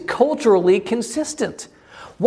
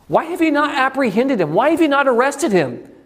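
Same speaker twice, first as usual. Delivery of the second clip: higher, pressing indignant questions; indignant leaders; 8 semitones higher than usual. Acted. Religious leaders rebuke the guards, indignant and high. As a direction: scold them for failing the job.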